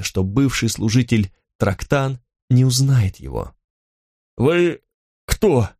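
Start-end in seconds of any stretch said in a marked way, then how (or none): 4.4-5.7 halting question, pitched up; understated strained, hesitant question; forced out with effort. A narrator's reading. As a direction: squeeze the words out with a pause between. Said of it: "через силу выдавил Трактан".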